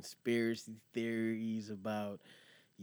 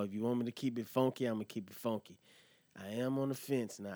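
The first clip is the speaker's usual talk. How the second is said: ordinary talk, nothing marked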